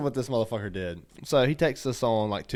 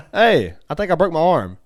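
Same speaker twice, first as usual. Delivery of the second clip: raised, high-pitched call; loud, urgent holler, re-enacted; calling through door; 7 semitones higher, 10 dB louder than usual. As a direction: call out to a parent behind a door. Acted calling to dad through door.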